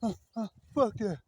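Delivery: high, stammering whiny protest; mock hollering. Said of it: "hooping and hollering and screaming".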